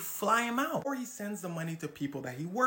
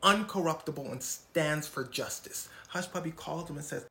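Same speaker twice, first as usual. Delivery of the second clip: usual speaking voice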